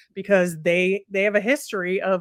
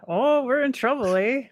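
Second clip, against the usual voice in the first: higher-pitched mock Canadian accent; 6 semitones higher than usual. Podcast host voicing the worried Leafs on request, mock Canadian accent. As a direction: put on a playful Canadian accent. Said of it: "you should have a Canadian accent".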